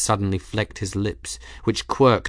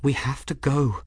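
higher-pitched, restrained urgency; quietly urgent; 5 semitones higher than usual. A narrator's reading. Bridget urging grieving Benedict to leave, quietly urgent. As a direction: press him to leave without raising volume. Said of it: "quietly urgent".

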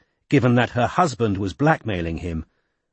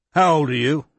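lightly voiced irritable older man; gruff politician; 5 semitones higher than usual. A narrator's reading. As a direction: grumble like an annoyed minister. Chisel voiced in story.

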